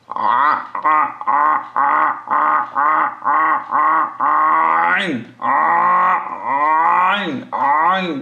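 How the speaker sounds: আ pushed from the middle of the throat; teacher drilling the ain from the throat; throat letter drill. Shown as a demonstration. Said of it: "গলার মধ্যখান থেকে আসতে হবে".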